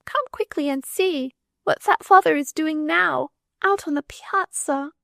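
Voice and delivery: put-on high child's voice, urgent; a small girl begging her nurse to come look; excited little girl. A narrator's reading. Little girl urging her nurse to come see.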